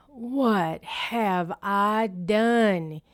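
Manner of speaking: slow, sorry sigh of a question; sighing dismay. A narrator's reading. Bear sighing as the geese fly off, slow. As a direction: let it out with a heavy sigh. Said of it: "he said with a sigh".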